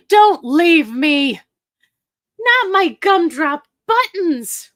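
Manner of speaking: high, slow, hammed-up wail of despair; mock wail. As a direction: plead like the Gingerbread Man in Shrek, drawn out. Pleading viewers not to leave.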